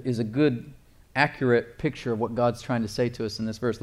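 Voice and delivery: usual speaking voice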